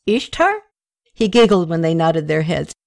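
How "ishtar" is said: high rising one-word question; tipsy man asks the locals about the fresco. Acted. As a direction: point and ask, delighted. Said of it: "pointed at the ... fresco and said".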